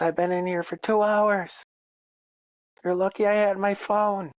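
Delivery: high-pitched imitation of an angry daughter; pissed-off daughter. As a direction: complain up high as the furious kid. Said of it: "she was fucking pissed".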